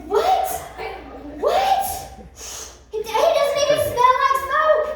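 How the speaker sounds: very high, loud amazed what; amazed crowd. Acted. Officials marvelling at the unburned men, very high and amazed. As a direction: squeal in disbelief.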